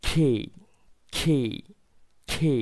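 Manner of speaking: খে said with an aspirated "kh" and a gliding "ay"; খে repeated with a breathy "k" onset; খে stressed like English "kay", breath puffed on the "k"; correct letter K. Shown as a demonstration. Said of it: "এটা সঠিকটা হবে".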